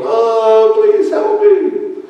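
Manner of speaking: put-on very high, stretched, panicked little-girl wail; mock sobbing plea of a scared girl; crying girl. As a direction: plead like a terrified child. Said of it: "crying and all this stuff".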